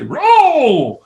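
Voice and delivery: sudden high yell over rapids; yelling at a rower to save the boat; shout. Retold or imitated. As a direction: bark the order loud and high. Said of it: "I might grab you and say".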